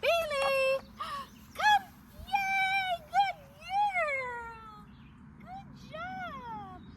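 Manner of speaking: high, loud, joyful call and praise; excited recall. Shown as a demonstration. Trainer calling the puppy back with big cheer. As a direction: whoop her name and cheer her in. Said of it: "I wanna be excited".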